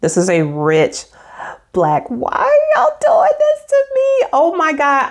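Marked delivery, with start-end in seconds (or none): 2.1-5.1 gushing excitement. Real, not acted. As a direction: gush like you're in love. Overjoyed by the pen.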